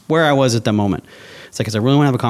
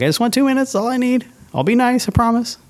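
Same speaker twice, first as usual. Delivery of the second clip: sweet pleading; 12 semitones higher than usual. Retold or imitated. Retold inner plea to pastor.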